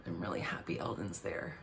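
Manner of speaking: low, very soft mimicry; quiet, deep mock-earnest delivery; hushed echo. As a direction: murmur it low, mimicking the show. Echoes his line in the clip.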